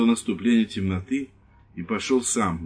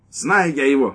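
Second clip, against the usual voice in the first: high, loud scornful cut-in; scornful interruption; 5 semitones higher, 6 dB louder than usual. Acted. Wife interrupting the lieutenant, loud and scornful. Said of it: "прервала женщина".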